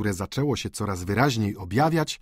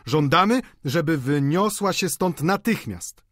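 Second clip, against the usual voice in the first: raised-voice demand, slowing at the end; insistent outcry; 6 semitones higher than usual. A narrator's reading. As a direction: demand it firmly, pitched up. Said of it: "zawołali wszyscy stołownicy". (podniesiony, stanowczy okrzyk)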